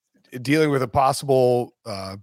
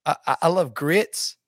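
lightly hammed-up Southern accent; fake Southern accent; 2 semitones higher than usual. Coach in game-day interview praising grits, fake Southern accent. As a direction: stammer through a put-on Southern accent. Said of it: "just faking a Southern accent".

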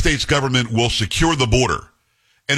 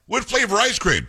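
higher, slow fawning question; lightly hammed-up reporter; mock reporter; 9 semitones higher than usual. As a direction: parody a gushing journalist. Mocking reporters' soft questions.

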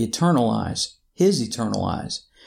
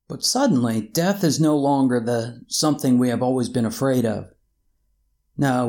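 ordinary talk

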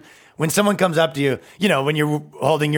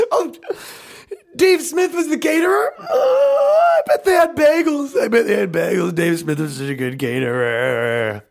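mock bawling; 7 semitones higher than usual. Man crying over the caterer in the credits, exaggerated wailing. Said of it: "He even cries in the credits".

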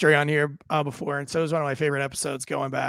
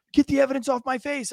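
high-pitched mock pleading urgency; frantic victim; 8 semitones higher than usual. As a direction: imitate a worked-up man making demands. Retold frantic victim to officer.

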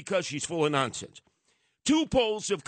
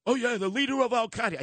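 mock bragging; 7 semitones higher than usual. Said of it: "everyone's bragging".

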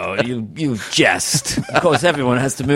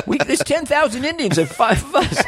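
much higher, whiny sidekick squeal; Walter Brennan; 13 semitones higher than usual. Retold or imitated. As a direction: panic high like an old sidekick. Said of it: "Walter Brennan".

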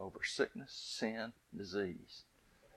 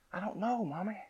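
put-on higher, softer little girl voice; 9 semitones higher, 5 dB quieter than usual. Scene: little girl tells mama she can't let go, higher and softer. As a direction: play a small pleading girl.

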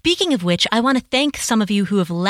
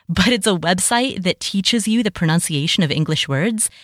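usual speaking voice

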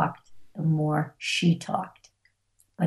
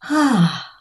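loud, higher exhale of relief; acted relief sigh; 4 semitones higher, 6 dB louder than usual. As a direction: let out a big relieved sigh. Relief at being validated.

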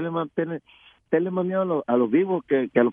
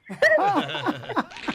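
high laugh; 3 dB quieter than usual. Real, not acted. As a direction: laugh at the joke. Guest laughs at joke.

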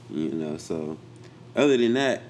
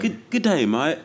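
high, playful British greeting; hammed-up British hello; mock British accent; 9 semitones higher, 4 dB louder than usual. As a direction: put on a cheery, higher British voice. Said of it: "on my British accent".

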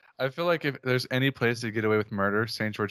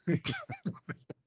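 high-pitched giggle burst; high squealing laugh; 9 dB quieter than usual. Real, not acted. Laughs at shit joke.

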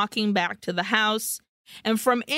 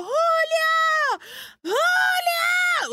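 imitated scream; much slower than usual. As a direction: shriek his name like the heroine. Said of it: "Paulina screams for Julio".

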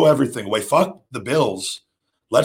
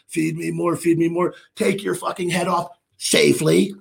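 higher, fired-up play-by-play of his own comeback; hyped comeback call; 6 semitones higher than usual. Retold or imitated. Wrestler narrating his big comeback to the crowd, fired up. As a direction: build the excitement move by move.

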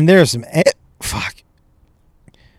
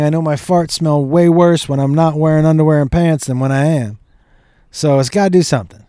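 usual speaking voice

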